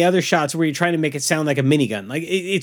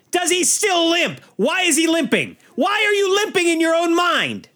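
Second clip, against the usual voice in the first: exasperated rant; 11 semitones higher than usual. Real, not acted. Ranting about the film's plot hole, exasperated. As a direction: wail each question in exasperation.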